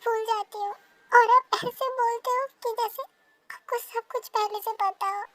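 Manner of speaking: angry. Acted. Girl scolding him for always just saying sorry, angry. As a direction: pour out years of resentment.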